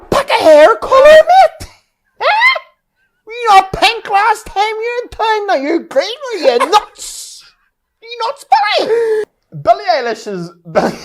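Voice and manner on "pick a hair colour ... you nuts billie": high, loud jeering shout; hammed-up small-town heckler; mock local heckler. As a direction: yell it across the street at her. Local heckling [name].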